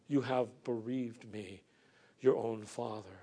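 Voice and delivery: soft, hushed echo of "You have bereaved me"; quiet repeat. Acted. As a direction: let it fall almost to a murmur. Quiet echo of Jacob's lament.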